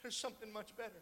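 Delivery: a private whisper of a thought growing into a declaration; Jesus' inner thoughts. Retold or imitated. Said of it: "I wonder if in his mind he thought".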